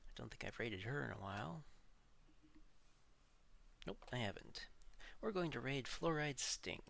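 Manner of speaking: usual speaking voice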